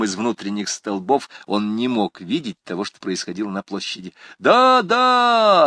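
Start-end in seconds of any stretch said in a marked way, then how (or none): 4.4-5.7 high, loud shout of yes; chorus shout. A narrator's reading. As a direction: shout it back together. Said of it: "ответили ему остальные".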